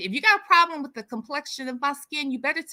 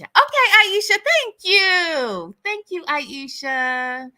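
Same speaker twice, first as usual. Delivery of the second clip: bright thanks; 6 semitones higher, 5 dB louder, slower than usual. Real, not acted. Thanking viewer warmly.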